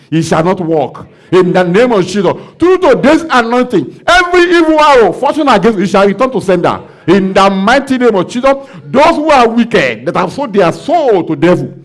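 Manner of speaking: loud, high declaring prayer over the congregation; full-force preaching. Acted. Pastor declaring victory over the congregation, loud and high. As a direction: proclaim it like a revival preacher.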